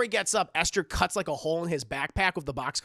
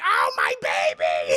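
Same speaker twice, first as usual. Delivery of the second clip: much lower, slow loud wail; anguished cry; 14 semitones lower, 8 dB louder, slower than usual. Retold or imitated. Mother watching the car roll away, slow loud wail. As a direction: cry out in horror.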